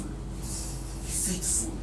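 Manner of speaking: whispered, very slow and drawn out. Real, not acted. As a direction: breathe the words out slowly, no voice. Preacher repeating the refrain in a whisper.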